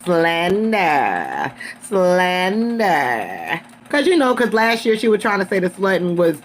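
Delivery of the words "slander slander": long drawn-out, slow word; hammed-up slow imitation; mock-dramatic quoting. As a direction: stretch the word out theatrically, mimicking a queen. Mimics the queen piping up.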